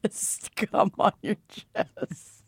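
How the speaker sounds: slow breathy whisper. Acted. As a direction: whisper it slowly and suggestively. Repeating the joke.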